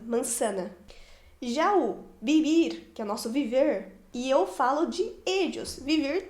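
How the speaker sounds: ordinary talk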